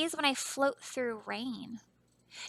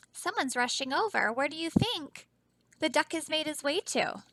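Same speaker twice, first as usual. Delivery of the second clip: usual speaking voice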